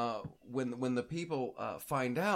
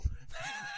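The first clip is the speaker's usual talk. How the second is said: high squealing laugh; 7 dB quieter than usual. Real, not acted. Laughs after shouting no.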